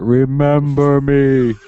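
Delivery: booming, drawn-out statue voice; Bender. Acted. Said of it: "the Bender statue on that Egyptian planet".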